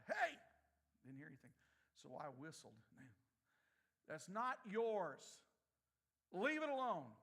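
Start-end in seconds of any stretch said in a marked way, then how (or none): 0.1-0.4 loud holler across the yard. Retold or imitated. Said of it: "I hollered out". 4.1-7.1 slow, firm fatherly command; stern grandfather voice, louder and slow. Retold or imitated. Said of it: "With my father, grandfather voice".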